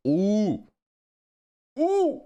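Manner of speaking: high, loud "ooh"; excited "ooh". Real, not acted. Streamer checking recent matches, high excited ooh. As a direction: let out a high-pitched, impressed "ooh".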